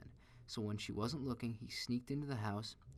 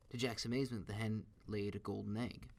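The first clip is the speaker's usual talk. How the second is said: usual speaking voice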